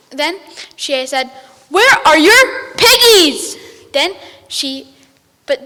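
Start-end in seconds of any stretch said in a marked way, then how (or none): 1.7-3.6 high, loud, slow witch voice; witch demand. Acted. Witch demanding piggies from the ducks, high loud witch voice. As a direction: draw it out like a cackling witch.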